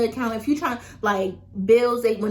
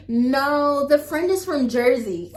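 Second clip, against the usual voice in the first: cracking up mid-sentence; real laugh spilling through; 3 semitones higher, 4 dB louder than usual. Real, not acted. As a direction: laugh out the words. Laughing at her own story.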